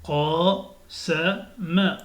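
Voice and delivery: syllabes lues très lentement; slow reading. Shown as a demonstration. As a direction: lis chaque syllabe doucement. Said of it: "il faut vraiment lire doucement". (lecture lente et détachée)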